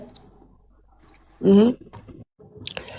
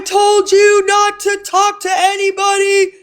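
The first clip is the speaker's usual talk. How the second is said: mock yell; 11 semitones higher than usual. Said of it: "when you were yelling and screaming".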